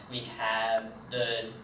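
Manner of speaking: ordinary delivery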